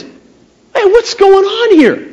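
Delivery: high, drawn-out indignant demand. Acted. David challenges the army over Goliath, acted indignation. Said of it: "he's indignant about this whole thing".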